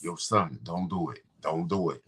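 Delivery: low street-guy warning; put-on deep voice. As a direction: warn her with the pitch pulled down. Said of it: "she know you not talk like that".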